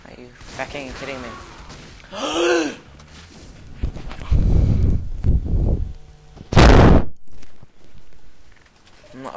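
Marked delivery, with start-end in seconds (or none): 1.3-8.6 long, high, breathy gasp of alarm; loud shocked gasp. Real, not acted. Gamer ambushed by an enemy, long shocked gasp.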